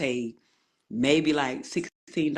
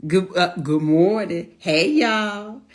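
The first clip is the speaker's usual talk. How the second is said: louder, slower call out; loud greeting; 4 semitones higher, 7 dB louder, slower than usual. Real, not acted. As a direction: call out the hello with energy. Host calls out to viewers.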